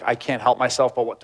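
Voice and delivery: usual speaking voice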